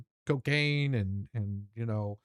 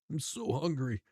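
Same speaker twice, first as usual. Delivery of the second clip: small, softer pretend whine; mock whimper; 5 dB quieter than usual. Partner pleading hunger to judge, soft mock whimper. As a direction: whimper it softly, playing up hunger.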